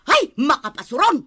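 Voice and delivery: loud, fed-up exclamation; exasperated outburst. Acted. Wife, sick of the quarrel, loud and exasperated. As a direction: throw up your hands.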